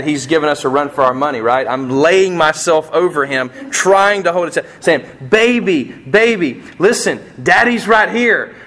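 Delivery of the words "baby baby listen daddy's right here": raised, urgent fatherly reassurance; retold plea, high and insistent; pleading father. Dad holding down his injured son, urgent pleading.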